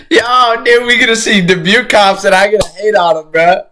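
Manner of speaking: real high-pitched laughing chatter. Real, not acted. Streamer teasing chat about hometown cops, laughing. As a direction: laugh through the words, voice riding high.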